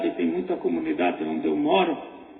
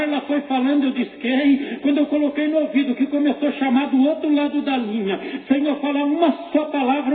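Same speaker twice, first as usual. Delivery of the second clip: urgent, raised storytelling from the pulpit; impassioned testimony preaching; 6 semitones higher than usual. Real, not acted. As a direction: drive the testimony forward with rising fervour.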